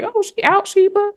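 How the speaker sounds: high, bright mock party-girl voice; way up high, teasing her own online image; mock glamorous outing voice. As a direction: play the carefree social media version of herself. Mock social media persona voice.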